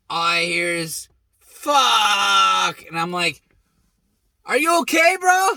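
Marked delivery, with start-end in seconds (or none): 1.4-2.8 sudden loud curse of pain; pained yell. Retold or imitated. Friend hurt jumping from car, yelling out in pain. Buddy crying out after crash. 4.4-5.5 high, loud call across the yard; worried call. Retold or imitated. Calling to buddy lying in the yard, high worried shout.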